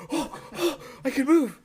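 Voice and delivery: high relieved oh, oh; relieved surprise. Retold or imitated. Retelling waking, a high relieved oh I can move.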